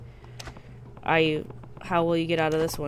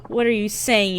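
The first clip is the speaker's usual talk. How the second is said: ordinary delivery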